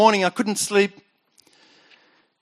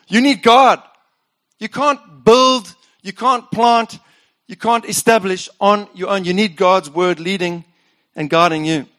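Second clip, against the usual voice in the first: preaching. Acted. Pastor urging the congregation, emphatic preaching. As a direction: drive each "you can't" home.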